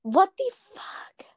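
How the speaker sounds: slow, hushed and incredulous; whispered disbelief. Real, not acted. Video stream glitching.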